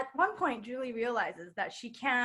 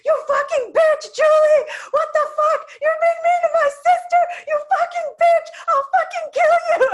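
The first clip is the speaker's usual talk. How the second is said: much higher and much louder, cursing at full shout; furious yelling brother; 13 dB louder than usual. Retold or imitated. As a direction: scream curses at the girl who hurt his sister. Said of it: "replicate exactly how my brother did this".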